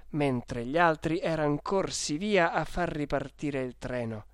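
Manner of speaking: plain reading voice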